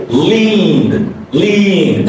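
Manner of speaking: 'leaned' with long vowel, n into voiced D; voiced D ending. Shown as a demonstration. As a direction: draw out 'leaned' as leend. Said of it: "final N is voiced consonant".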